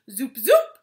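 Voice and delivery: loud zoop sweeping upward; magic wand zoop. Acted. Father waving his magic wand, rising zoop. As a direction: wand zaps, second one swooping up.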